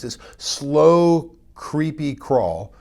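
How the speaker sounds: slow drawn-out. Real, not acted. Coach describing sliding sales, drawn out slowly. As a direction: stretch the words out, very slow.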